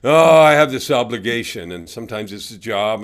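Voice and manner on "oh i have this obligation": loud, weary mock complaint; mock burdened sigh. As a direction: groan about the burden, hammed-up.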